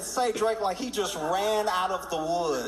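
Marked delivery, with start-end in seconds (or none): 0.0-0.6 lightly voiced Kendrick. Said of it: "whipped around and said".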